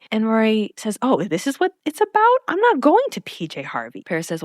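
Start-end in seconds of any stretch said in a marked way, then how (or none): 1.0-4.0 high-pitched acted indignation; incredulous, pushed-up retort; indignant realization. Rory to Paris about the concert, indignant.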